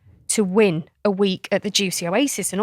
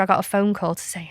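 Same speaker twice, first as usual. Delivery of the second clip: ordinary talk, nothing marked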